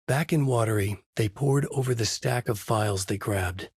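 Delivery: ordinary delivery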